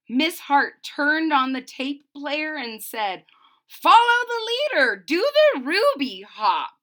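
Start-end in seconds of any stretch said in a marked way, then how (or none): 3.7-6.8 much higher, louder rallying call; rally the class to dance. Acted. Miss Hart calling the class to hop along, loud.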